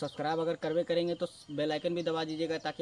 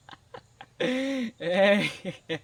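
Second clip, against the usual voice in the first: a loud burst of real laughter; hearty laugh; 5 dB louder than usual. Real, not acted. Storyteller cracking up at his own punchline, loud laugh.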